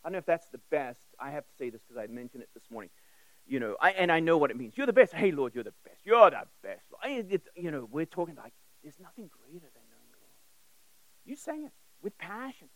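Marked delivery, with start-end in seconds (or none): none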